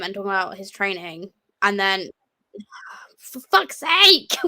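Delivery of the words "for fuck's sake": drawn-out, fed-up groan of words; slow, pitched-up exasperation; exasperated. Real, not acted. Internet cutting out again.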